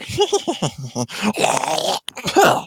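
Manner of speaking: high squealing cackle from Stitch; alien cackle. Retold or imitated. Kid imitating Stitch, high gleeful cackle. Stitch reacting in retold scene.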